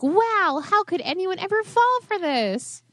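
high mocking voice; mock the naive viewer; mocking disbelief. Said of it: "while you're like".

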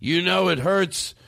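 put-on high, slow whiny drunk uncle; drunk uncle whine. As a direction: drawl it like a self-pitying drunk uncle. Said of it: "He'd be like".

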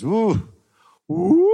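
rising party woo; drunk whoop. Retold or imitated. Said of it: "and I was".

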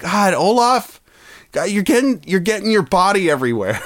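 high, whiny complaint; mock exasperation at a clingy snowman; exasperated friends. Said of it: "everyone's like".

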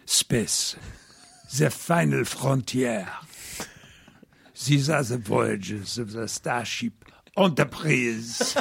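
slow, grand voiceover in a hammed-up French accent. Shown as a demonstration. Demoing the abandoned French Picard opening, slow French accent. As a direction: intone the Star Trek opening like a Frenchman. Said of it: "with a French accent".

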